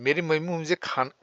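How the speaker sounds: normal talking voice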